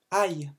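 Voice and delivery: "Aïe" said like a little cry of pain, "eye"; mock ouch to teach the letter I. Shown as a demonstration. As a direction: make "Aïe" sound like you got a boo-boo. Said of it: "tu fais comme si que t'avais un bobo".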